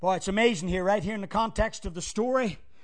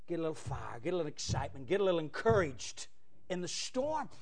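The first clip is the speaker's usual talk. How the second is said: ordinary delivery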